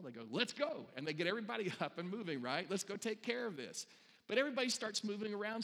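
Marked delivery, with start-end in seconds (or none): 0.3-0.9 high, loud rallying call; rallying leader. Acted. Leadership-gifted person sees the tray fall, rallying call. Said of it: "person with the gift of leadership". 2.7-3.9 higher-pitched acted rallying call; rallying leader. Leader gets everybody up and moving, urgent rally. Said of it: "person with the gift of leadership".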